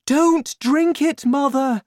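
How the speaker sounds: high-pitched, panicked mother voice; shouted warning. A narrator's reading. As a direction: shout urgently as the daughter. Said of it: "Missus Cranky shouted".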